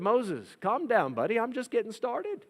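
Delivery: high, reassuring voice; calm down a nervous friend; calming God. Retold or imitated. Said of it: "He said".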